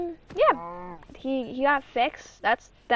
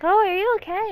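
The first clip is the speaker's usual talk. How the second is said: higher, louder worried check; imitated concern; 7 semitones higher, 5 dB louder than usual. Sibling checking on brother after a fall, higher concerned voice. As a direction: ask with raised, urgent mock concern.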